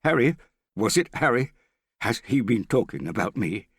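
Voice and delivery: angry. A narrator's reading. Morgan demands to know who talked about him, angry. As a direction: demand answers, loud and suspicious. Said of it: "he said angrily".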